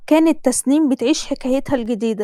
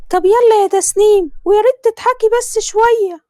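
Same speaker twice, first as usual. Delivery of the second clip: higher, louder pushy Egyptian mother; a mother hurrying her daughter, pitched up; Tasneem's mother; 9 semitones higher, 5 dB louder than usual. Acted. Mother voiced in story. (صوت أم مصرية عالي ومستعجل)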